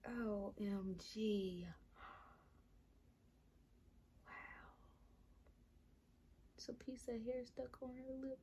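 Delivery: quiet amazed whisper; hushed whisper. Real, not acted. Hushed amazement at doll details.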